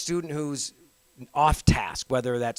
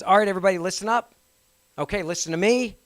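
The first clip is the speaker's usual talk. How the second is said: raised, higher attention call; teacher voice; 5 semitones higher, 4 dB louder, faster than usual. Shown as a demonstration. Teacher giving the class an attention phrase, raised teacher voice. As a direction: call the room to order. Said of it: "the teacher voice".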